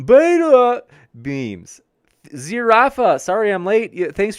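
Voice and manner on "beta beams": "beta" stretched slow, much higher and louder; drawn-out beta. Shown as a demonstration. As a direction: belt out "beta" long and high for the viewer. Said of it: "I just wanna hear you say beta".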